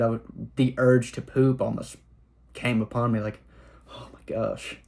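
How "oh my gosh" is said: quiet, lower, fearful breath; hushed dread. Retold or imitated. Urge of fear hitting the kid.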